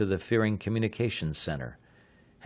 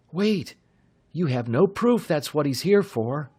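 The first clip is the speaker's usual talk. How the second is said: put-on higher, urgent young voice; eager young man; 8 semitones higher, 3 dB louder than usual. A narrator's reading. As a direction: stop him with youthful urgency. Bud objects.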